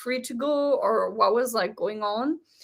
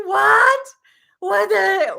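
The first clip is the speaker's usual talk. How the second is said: high, loud laughing shout of disbelief; shocked laughing yell; 8 semitones higher, 7 dB louder, slower than usual. Retold or imitated. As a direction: scream it through a laugh. Retelling shocked reaction.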